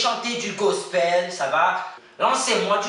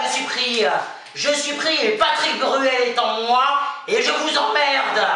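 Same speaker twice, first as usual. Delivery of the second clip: wild celebration; 9 semitones higher than usual. Acted. Contestant celebrates being selected.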